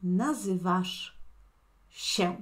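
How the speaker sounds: nazywasz się said slowly with a clear sh; slow teaching demo. Shown as a demonstration. Teacher modelling te llamas in Polish, slowly. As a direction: stretch it so the ending is heard. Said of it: "te llamas".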